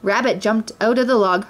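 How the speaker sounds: ordinary delivery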